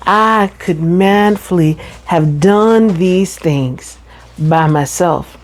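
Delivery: ordinary delivery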